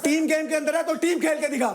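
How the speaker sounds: high-pitched, contemptuous jeer; angry taunt. Real, not acted. Shouting after her as she leaves.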